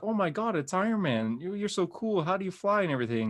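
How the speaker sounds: put-on high-pitched starstruck child; imitating excited kids. Schoolkids meeting Iron Man at soccer, mock excitement.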